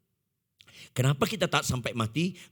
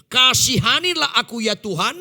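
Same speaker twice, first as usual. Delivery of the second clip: loud, high pleading cry; a Canaanite woman begging the Lord aloud; 8 semitones higher, 8 dB louder than usual. Acted.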